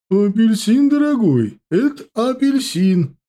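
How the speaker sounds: put-on high, loud old trader's voice; creaky old woman voice. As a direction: answer like a loud old market granny. Old vendor answers loudly.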